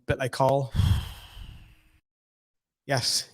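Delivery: ordinary delivery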